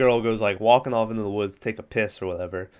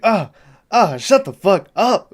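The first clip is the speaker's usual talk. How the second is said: high, loud mock annoyance; imitated exasperated outburst; mock exasperation; 8 semitones higher, 6 dB louder than usual. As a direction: cut off a screamer, loud and high. Turkey annoyed by girl's screaming.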